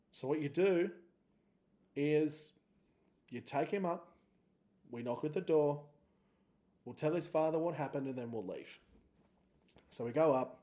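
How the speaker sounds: usual speaking voice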